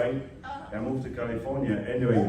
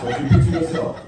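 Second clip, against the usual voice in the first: higher and louder, laughing through the words; 10 dB louder, faster than usual. Real, not acted. Speaker joking with seminar audience, laughing quickly. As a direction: crack up while repeating the point.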